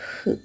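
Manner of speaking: "h" as a bare breath out with no vowel; "h" as a puff of air; isolated h sound. Shown as a demonstration. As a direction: breathe "h" alone. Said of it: "Example, horse".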